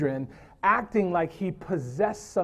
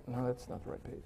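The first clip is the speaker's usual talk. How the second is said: much softer, lower aside to himself; muttered aside; 5 semitones lower, 15 dB quieter, faster than usual. Real, not acted. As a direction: mutter it under your breath, off the sermon. Loses place in notes, mutters aside.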